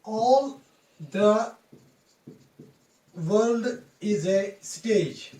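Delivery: very slow, with long pauses between words; slow dictation. Shown as a demonstration. Quoting a famous line for students, slow dictation. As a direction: spell it out word by word, drawn out.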